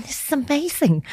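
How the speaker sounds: high delighted exclamation; high delighted cry while creaming her sister. Retold or imitated. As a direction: say it high with joy.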